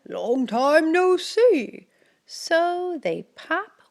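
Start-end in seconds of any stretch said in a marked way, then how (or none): 0.1-1.9 louder, lightly voiced hare; a friendly hare recognising Mouse. Hare greets mouse in the den. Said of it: "says Hare".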